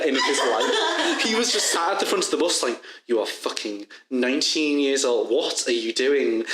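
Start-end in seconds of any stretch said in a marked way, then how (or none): none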